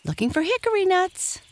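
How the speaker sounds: high, quick chipmunk chirp; teasing chipmunk. A narrator's reading. Striped Chipmunk teasing Happy Jack about nuts, high quick voice. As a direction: pipe it up high and fast, playfully.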